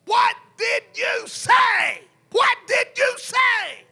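high, drawn-out loud demand; menacing shout. Retold or imitated. Possessed man charges up behind the preacher, slow intimidating shout. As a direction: shout it slowly, word by word. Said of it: "he shouted to me".

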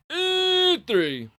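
very high, loud, drawn-out wail dropping on the number; over-the-top high announcer cry; game show announcer call. Acted. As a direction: belt out a darts game show prize number.

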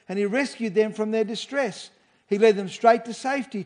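ordinary talk

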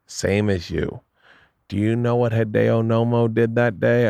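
slow, drawn-out storyteller impression; Barry's voice. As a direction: imitate a manager stretching out a motivational tale. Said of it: "you gotta know Barry's voice a little bit".

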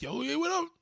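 high-pitched mimicked greeting; Haitian fans. Imitating Haitian people who love him, high-pitched greeting. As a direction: greet excitedly as a Haitian admirer. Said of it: "they be like".

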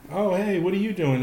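breezy high-pitched imitation of casual kids; mock-cavalier kid greeting, high. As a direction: toss it off lightly, pitched well up. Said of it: "the kids were so cavalier about it".

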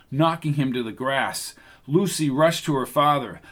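usual speaking voice

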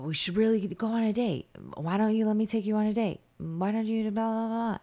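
high, singsong nagging imitation; mock pestering suitor. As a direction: parrot someone begging for a date. Mimics suitor pestering for a date.

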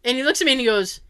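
ordinary talk

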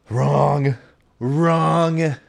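high, loud, punchy declaration; emphatic wrong. Real, not acted. Telling the audience his hopes were dashed, loud and emphatic. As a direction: slam the twist home.

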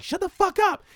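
high shouted snap; high mock yell in the theater; mock kid shout. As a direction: yell it like an angry nine-year-old. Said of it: "and I was like".

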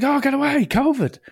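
high hammed-up voice, dismissive; mock rude lad. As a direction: ham up the jumper brushing him off. Mocking the rude queue-jumper.

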